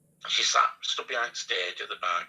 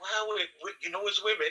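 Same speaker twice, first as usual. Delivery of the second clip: high quoted voice; 9 semitones higher than usual. Retold or imitated. Cheeky Girl quoted, high voice.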